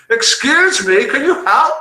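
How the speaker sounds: high, loud call from a distance; shouting down. Acted. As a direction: call out for help to someone far below. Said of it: "He descended a bit more and shouted".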